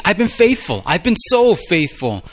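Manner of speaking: high, insistent, slowing on so faithful; Elijah protesting. Retold or imitated. Elijah insisting to God he's been faithful, high and indignant. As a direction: protest your own faithfulness. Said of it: "Or he's saying".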